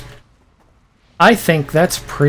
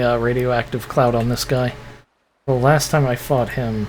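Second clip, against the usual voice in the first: usual speaking voice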